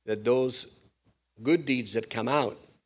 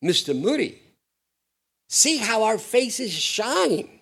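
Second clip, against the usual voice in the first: higher and slow, boasting innocently; young man boasting; 10 semitones higher, 5 dB louder, slower than usual. Retold or imitated. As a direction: draw it out like a beaming proud kid. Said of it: "this young guy says".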